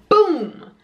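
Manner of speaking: high, sudden mock boom; imitated bang. Vlogger retelling a tire popping on the expressway, imitated bang. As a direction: punch out an imitation of a tire blowing. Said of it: "very loud noise".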